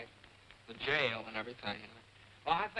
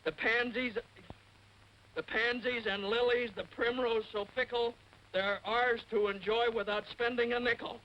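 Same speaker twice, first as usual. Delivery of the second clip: higher, sing-song poem recital; 9 semitones higher, slower than usual. Acted. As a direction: recite it like a sweet verse. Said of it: "I want to share a little poem".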